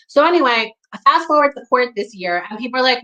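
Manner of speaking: ordinary talk, nothing marked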